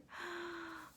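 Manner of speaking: sharp high intake of breath in wonder; awed gasp. Real, not acted. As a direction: catch your breath at something beautiful. First look at the cover.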